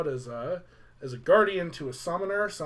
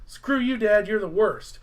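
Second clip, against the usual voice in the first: higher, louder mock outburst; hammed-up resentful son; mock angry; 5 semitones higher, 6 dB louder than usual. Titus cursing pictures of his dad, mock angry.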